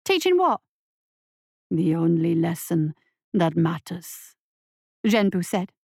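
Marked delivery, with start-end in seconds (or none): 0.0-0.6 put-on higher, urgent young voice; scared boy. A narrator's reading. Boy questions monster. 1.7-4.4 monster voice. A narrator's reading. Monster lectures boy.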